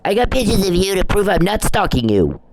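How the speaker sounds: high-pitched mocking imitation; Perry. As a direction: mimic him protesting in a put-on high voice. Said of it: "Perry's like".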